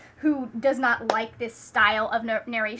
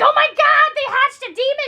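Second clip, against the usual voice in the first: much higher and louder, mock panic; panicked vicar; 12 semitones higher, 10 dB louder than usual. Vicar reacting to the dragon egg hatching, hammed-up panic. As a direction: shriek it like the egg hatched a monster. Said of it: "He's like".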